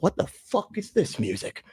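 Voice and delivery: high, puzzled mock disbelief; baffled reaction. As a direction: replay the confused first listen. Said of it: "I was like".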